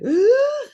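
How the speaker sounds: loud, high-pitched mock gasp; sudden revival gasp. Imitating the fainted woman coming round, sudden gasp. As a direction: gasp as if coming back to life.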